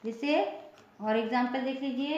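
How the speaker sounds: normal talking voice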